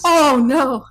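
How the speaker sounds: loud, high comic dismay; mock dismay. Co-host hears everybody dies, in loud mock dismay. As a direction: cry out in playful heartbreak.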